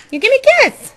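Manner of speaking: high, loud coaxing squeal; excited high squeal. Real, not acted. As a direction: squeal it at the bird. Coaxing pet bird for kiss.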